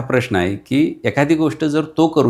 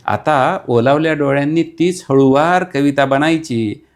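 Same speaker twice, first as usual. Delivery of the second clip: dramatic repeat; 4 semitones higher than usual. Acted. Repeat raised, poetic stress.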